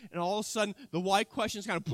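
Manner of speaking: usual speaking voice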